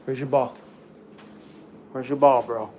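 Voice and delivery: ordinary talk, nothing marked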